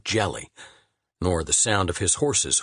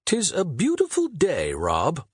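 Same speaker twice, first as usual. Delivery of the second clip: a touch of lofty poet; lightly voiced poet; 8 semitones higher than usual. A narrator's reading. Shakespeare admiring the day to his friend. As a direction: declaim it gently as the poet. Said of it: "and he said".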